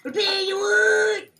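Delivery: loud hillbilly yell of the catchphrase. Pretend. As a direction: bellow it like a rowdy country boy. Hick voice shouting catchphrase.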